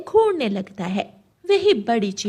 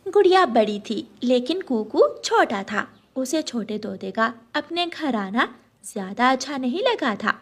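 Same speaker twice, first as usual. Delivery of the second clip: usual speaking voice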